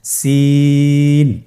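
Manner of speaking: সিন with the vowel held three counts, thin and light; Arabic letter lengthened for learners; three alif stretch. Shown as a demonstration. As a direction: draw সিন out long. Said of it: "আবার উচ্চারণ করুন".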